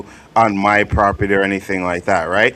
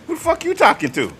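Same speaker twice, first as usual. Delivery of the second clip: mock-indignant comeback, pitched much higher; indignant retort. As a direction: throw it back as a high, offended challenge. Acted quarrel in retold story.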